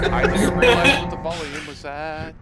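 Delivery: loud laugh through the words. Real, not acted. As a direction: laugh it off, embarrassed. Laughing at own loud music.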